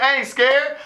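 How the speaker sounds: ordinary talk, nothing marked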